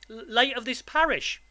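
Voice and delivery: put-on high, stammering child's voice; timid boy. A narrator's reading. Boy quotes father's gravestone to convict, nervous. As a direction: stumble nervously on the first sound.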